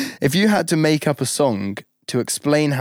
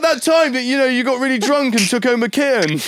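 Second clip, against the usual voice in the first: fan voice; 9 semitones higher, faster than usual. Retold or imitated. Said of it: "they'd be like".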